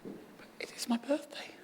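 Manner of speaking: bewildered unvoiced whisper. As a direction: breathe it out in disbelief. Retold bewildered whisper on phone.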